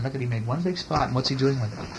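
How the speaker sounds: plain reading voice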